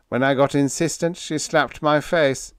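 high, mildly regretful confession; lifted, rueful little complaint. A narrator's reading. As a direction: admit it with regret. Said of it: "shook his head regretfully".

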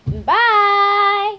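high loud sign-off; cheery goodbye. Real, not acted. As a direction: sing out the goodbye. Cheery outro farewell.

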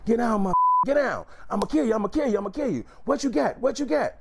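high-pitched, frantic robber's holler; imitated hollering. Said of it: "He's hollering as he's telling me this".

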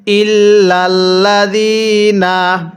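ইল্লাল্লাযিনা chanted slowly, lam doubled, ya stretched one alif; tajweed recitation. Shown as a demonstration. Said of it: "তাহলে কিভাবে পড়ব?".

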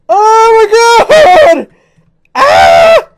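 very high, very loud shriek; terrified scream. Real, not acted. Player shrieking as she drops suddenly, startled.

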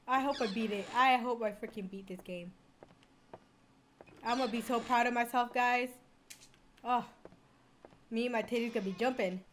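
ordinary delivery

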